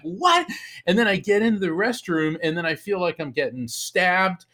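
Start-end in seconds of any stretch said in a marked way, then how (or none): 0.0-0.5 high-pitched startled yelp of a question; startled disbelief. Retold or imitated. Retelling a phantom punch to the head, startled. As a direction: act out a sudden, baffled reaction.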